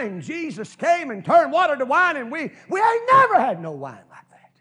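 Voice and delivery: high, loud and fast, building to a shout; old wedding guest whooping about the wine to the grandchildren; excited old storyteller. Retold or imitated. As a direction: tell the grandkids with mounting glee.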